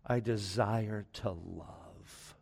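hushed, low and very slow retold reply; quietly emphatic. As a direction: imitate a firm answer softly, drawing out each word. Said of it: "She stopped them emphatically and she said".

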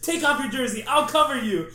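loud retold insistence to a hesitant friend; retold shouting. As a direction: yell at your friend to take the jersey off. Insisting Sid gets jersey signed.

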